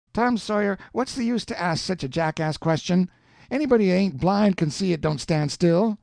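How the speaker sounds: put-on high-pitched, protesting young voice; a boy insisting he's right; indignant boy Huck.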